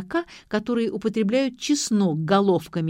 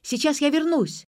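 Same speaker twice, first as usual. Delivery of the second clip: high shouted promise; raised calling voice; 8 semitones higher, 3 dB louder than usual. A narrator's reading. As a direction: call back over your shoulder. Vik calls back being dragged.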